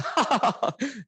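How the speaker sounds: high bursting laugh at herself; burst of laughter. Real, not acted. Laughing at herself before imitating her client-call voice.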